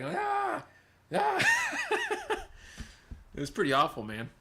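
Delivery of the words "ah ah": very high, rising cries with a laugh; hammed-up pain screams through laughter; retold screaming. Kid hears patient screaming from the back room, laughing. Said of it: "heard me screaming in the back".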